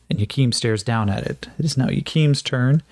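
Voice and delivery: normal talking voice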